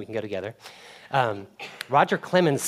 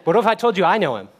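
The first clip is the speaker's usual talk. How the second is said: playful challenge; 5 semitones higher, 8 dB louder than usual. Acted.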